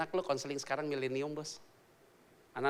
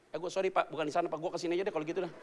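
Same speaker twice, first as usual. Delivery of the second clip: flustered rush; 3 dB quieter, faster than usual. Retold or imitated. Flustered Peter backing off.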